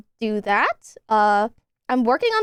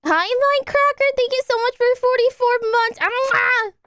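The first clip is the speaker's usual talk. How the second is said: excited high thanks; 11 semitones higher than usual. Real, not acted.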